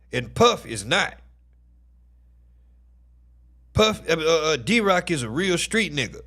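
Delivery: high, loud insistence; heated rant. Real, not acted. Streamer rants to chat about D-Rock, loud and heated. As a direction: hammer the point home.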